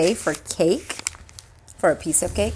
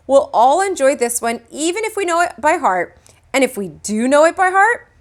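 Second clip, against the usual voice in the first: strained patience; 7 semitones higher, 3 dB louder than usual. A narrator's reading. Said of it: "starting to lose her patience".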